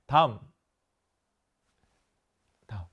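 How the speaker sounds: ordinary delivery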